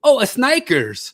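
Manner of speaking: put-on high, loud, slow Mexican-accented realization; "Snickers" as es-NIKE-ers, Mexican accent; exaggerated Mexican accent, suddenly getting it; Mexican Spanish accent. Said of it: "the Mexicans used the American word".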